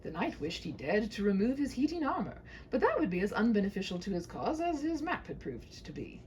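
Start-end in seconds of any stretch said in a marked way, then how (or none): none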